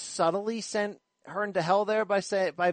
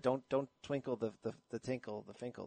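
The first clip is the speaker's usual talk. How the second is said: quiet retelling; 7 semitones lower, 15 dB quieter than usual. Retold or imitated. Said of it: "like Schaefer said".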